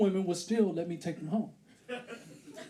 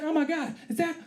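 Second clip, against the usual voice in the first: high, hesitant woman's voice; imitated woman; 8 semitones higher than usual. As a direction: ask it high and halting, as a wary date. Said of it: "She's like".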